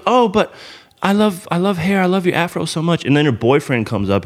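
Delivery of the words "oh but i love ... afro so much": put-on high, gushing girly voice; gushing woman. Woman at the bar gushing over his hair, high gushing voice. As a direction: gush over the afro. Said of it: "she's touching my hair and goes".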